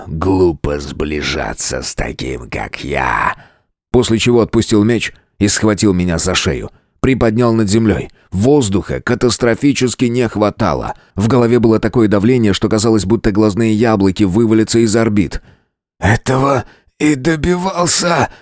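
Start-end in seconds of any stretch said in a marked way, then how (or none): 0.1-3.4 deep, much softer beast; monster voice. A narrator's reading. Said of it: "произнёс он". 16.0-18.3 strained, choked rasp; hoarse. A narrator's reading. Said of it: "прохрипел я".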